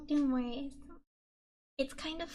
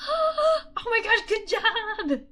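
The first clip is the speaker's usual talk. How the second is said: gasp, then squeal it high and loud, slowly; bursting with pride for them; gasping excitement; 7 semitones higher, 8 dB louder, slower than usual. Real, not acted. Streamer cheering a viewer's test score, excited. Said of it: "I'm getting so excited for you guys".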